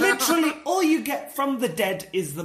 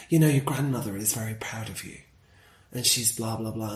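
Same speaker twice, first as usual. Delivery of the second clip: low-voiced mimicry; 10 semitones lower than usual. Mimics mediums consoling her.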